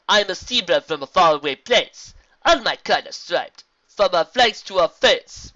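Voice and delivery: loud, slowing rhyming zebra voice; friendly zebra. Acted. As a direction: present yourself with stately poise. Zebra explains herself proudly.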